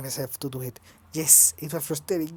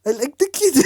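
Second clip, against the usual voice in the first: put-on higher German accent try, "the" stressed; attempted German accent; 11 semitones higher, 7 dB louder than usual. Kurt speaking of the boy, German accent try. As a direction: push a German accent onto "I like the kid". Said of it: "I can't do a German accent".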